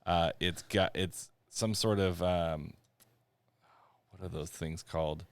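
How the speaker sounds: ordinary talk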